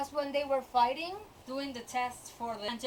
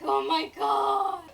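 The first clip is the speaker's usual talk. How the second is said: loud, higher, slow "Oh my god!"; exasperated shout; 4 semitones higher, 6 dB louder, slower than usual. Retold or imitated. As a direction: explode in disbelief. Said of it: "And I'm just like".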